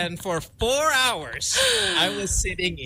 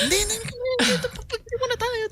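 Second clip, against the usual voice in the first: much higher, insistent hindi hindi; high-pitched flight attendant. Retold or imitated. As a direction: wave him off in a high voice. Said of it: "And they're like".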